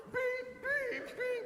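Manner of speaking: imitated monitor beeps. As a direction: chirp it like a heart monitor. Monitor still going.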